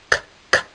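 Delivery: whispered k k clink; clanking keys. Acted. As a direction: click it like keys.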